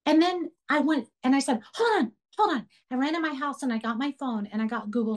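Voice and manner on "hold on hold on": high-pitched, hurried-off "hold on"; re-enacted plea to wait, pitched high; retold urgent call. Retold call to waiting neighbour.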